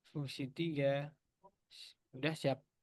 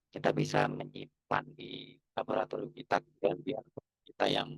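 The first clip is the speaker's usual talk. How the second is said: glitchy robotic headset voice; half robot voice. Real, not acted. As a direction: talk through a broken robot filter. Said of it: "Suaranya kayak robot dah".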